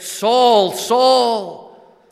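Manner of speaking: a loud, high call that stops him in his tracks; commanding call. Acted. The Lord stopping Saul on the Damascus Road, loud high call. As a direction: call out his name with authority. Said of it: "the Lord intervened".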